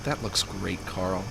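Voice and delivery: low, hushed patronising praise; Jimmy. Acted. Jimmy humouring Carl about his drawing, soft and condescending. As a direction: drop it down as if soothing a toddler. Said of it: "it's like he's talking to a toddler".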